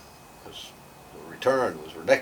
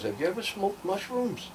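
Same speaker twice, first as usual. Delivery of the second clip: put-on girl's question; 11 semitones higher than usual. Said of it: "she goes".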